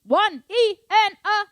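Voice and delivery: every syllable of "one E and a" belted high and loud; accented counts. Shown as a demonstration. Drum teacher singing singles, loud accented counts. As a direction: punch each beat of "one E and a" hard. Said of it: "Single is obviously".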